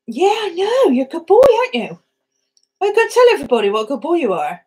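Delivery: high, loud sing-song talk to a dog; fast, high pet-talk praise. Real, not acted. Talking to her dog.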